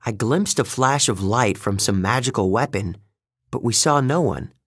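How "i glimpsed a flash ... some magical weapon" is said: plain reading voice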